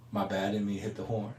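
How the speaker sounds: soft, sheepish apology. Retold or imitated. Driver apologizing, quoted.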